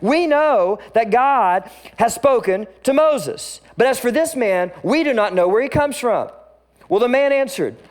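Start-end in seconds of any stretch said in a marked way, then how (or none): 0.0-6.3 high, loud and smug; mock haughty religious leaders; arrogant leaders. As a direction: sneer it like you own the truth. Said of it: "hear the arrogance dripping from their voice".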